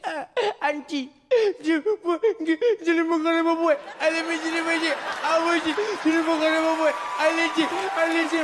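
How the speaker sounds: put-on high, gasping, tearful kid babble; crying child cousin. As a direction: blubber breathlessly like a tattling child.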